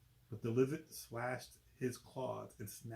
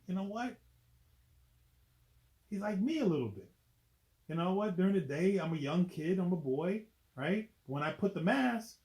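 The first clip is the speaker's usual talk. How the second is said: high, bright hero voice, louder; excited Spider-Man; 7 semitones higher, 5 dB louder than usual. Acted. Reader voicing Spider-Man to kids, high and excited. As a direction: pump up the young hero. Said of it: "Spider-Man is like".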